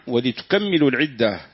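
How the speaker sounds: ولتكملوا said walitukammilu, mim doubled. Shown as a demonstration. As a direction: lean on a long double m in ولتكملوا. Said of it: "بالتشديد".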